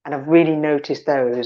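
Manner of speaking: usual speaking voice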